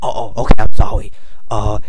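usual speaking voice

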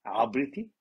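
normal talking voice

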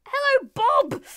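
high, loud, delighted hail; excited shout. Real, not acted. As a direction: call out joyfully to the villager Bob. Shouting greeting to villager.

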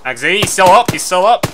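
urgent shout over gunfire. Real, not acted. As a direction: yell it out, high and loud. Calling out under fire.

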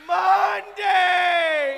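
loud, high, whiny shout; whiny Monday voice. Pretend. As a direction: whine out the day like a grumpy kid. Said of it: "What's your whiny Monday voice?".

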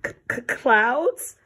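scared bear stammering clouds; frightened stammer. Acted. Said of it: "stammered Lars".

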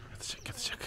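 whispered so as not to disturb; excited whisper. Real, not acted. Vlogger whispering to viewers as the mare lies down. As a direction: hush it under your breath.

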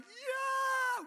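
high, loud imitated cheer; mock kids' cheer. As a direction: shout back like excited kids. Kids answering the party call.

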